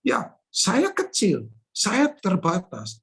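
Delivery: slow, deliberate "saya kecil, saya terbatas"; slow humble admission. Acted. Preacher confessing his smallness to the congregation, slow. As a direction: weigh each phrase slowly.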